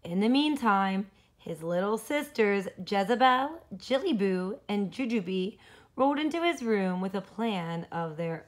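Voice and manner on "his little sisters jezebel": plain reading voice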